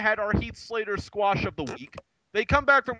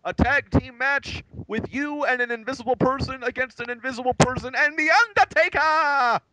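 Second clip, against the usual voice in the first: pompous GM announcement; 6 semitones higher than usual. Retold or imitated. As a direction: announce it like a blustering boss. Voicing Teddy Long announcing match.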